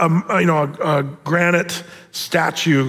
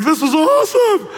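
delighted; 10 semitones higher than usual. Acted. Lazarus marvelling at paradise, thrilled high exclamation. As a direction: gush about paradise.

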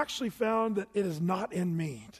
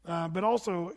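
ordinary delivery

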